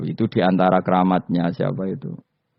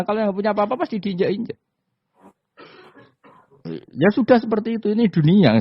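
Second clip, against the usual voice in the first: emphatic; 12 semitones higher than usual. Real, not acted. Emphatic high point.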